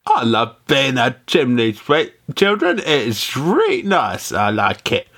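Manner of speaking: put-on, drawn-out Cockney; slow, clumsy mock Cockney; Cockney accent. Shown as a demonstration. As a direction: try a broad Cockney, stretching the words. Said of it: "That's my Cockney accent".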